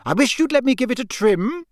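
high, scolding mum voice; a mother fretting over her son's earring; fussing mother. Acted. Mrs Weasley voiced in story.

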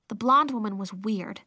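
ordinary delivery

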